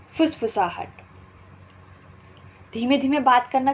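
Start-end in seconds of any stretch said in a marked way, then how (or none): none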